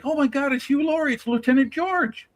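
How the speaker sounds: sudden high-pitched delighted realisation; surprised recognition. Retold or imitated. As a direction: blurt it out as if suddenly recognising a familiar face. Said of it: "I was like".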